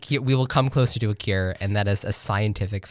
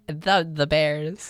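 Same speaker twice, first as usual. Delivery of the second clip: put-on slow, thick Chicago accent; 4 semitones higher, much slower than usual. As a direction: say it like a Chicago sports fan. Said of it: "That's my Chicago accent".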